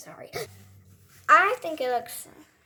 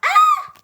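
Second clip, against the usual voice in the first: very high, loud shriek; startled scream; 7 dB louder than usual. Pretend. Toy suddenly startled, high shrill scream.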